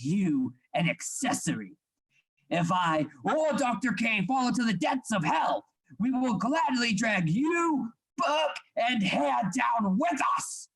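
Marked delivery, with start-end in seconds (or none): 2.5-10.7 furious shouted threat to a reluctant accomplice. Acted. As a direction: drag the words out as a menacing shout. Heated threat in the quarrel.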